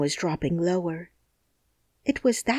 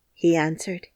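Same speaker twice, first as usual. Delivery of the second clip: ordinary delivery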